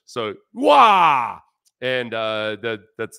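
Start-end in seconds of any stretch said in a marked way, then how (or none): 0.5-1.4 high, loud "Wah!" with a long falling tail; Korean wow. Shown as a demonstration. Host demonstrates the spelled-out word, loud drawn-out exclamation. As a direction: belt out "Wah!" as one stretched syllable. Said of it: "it's spelled U W A in English".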